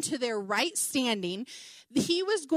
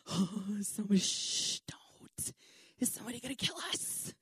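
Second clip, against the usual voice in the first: hushed, nervous shushing; imitated panicky whisper, very soft; furtive whisper; 3 semitones lower, 8 dB quieter than usual. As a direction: whisper as if someone might overhear. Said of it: "looking around furtively".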